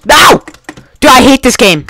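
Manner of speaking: high, loud cry of protest; frustrated yell. Real, not acted. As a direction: burst out in exasperation. Rages after losing again.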